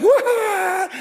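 high, loud comic cry; mock yelp. Othniel crying out at the challenge. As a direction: yelp like a startled suitor.

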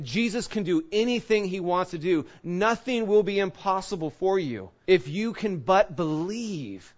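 ordinary delivery

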